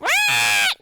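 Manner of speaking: loud screeching parrot squawk; harsh bird shriek, imitated.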